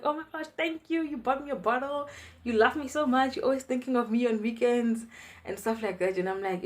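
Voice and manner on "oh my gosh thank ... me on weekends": high, gushing thank-you voice of the fun aunt; aunt gushing high over a wine gift. Retold or imitated. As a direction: pitch it up like a delighted aunt.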